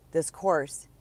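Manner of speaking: ordinary talk, nothing marked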